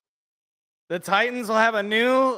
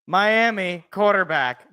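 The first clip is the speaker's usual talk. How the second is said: ordinary delivery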